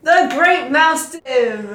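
loud, slow triumphant answer; excited guess. Real, not acted. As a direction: call out the answer proudly.

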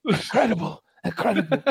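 high mock-awed murmur; awed media. Media praising the mumbling leader, high mock awe. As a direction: pretend to marvel at a great leader. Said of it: "And they're like".

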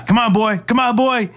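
high calling to a dog; mock yell. Imitating the cop calling his dog. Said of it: "he's yelling to his dog".

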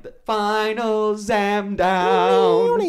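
very slow, stretched announcer call; drawn-out announcement. Acted. Host closing the segment, drawn-out announcer call.

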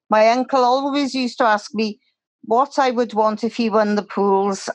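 ordinary delivery

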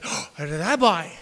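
mock awe. Congregation hears the preacher's trick, mock awed. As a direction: stretch it out, dazzled.

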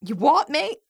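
put-on high, loud stab at a British accent; attempted British accent. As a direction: try a cheeky British "mate" accent. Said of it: "I'm gonna read it in accent".

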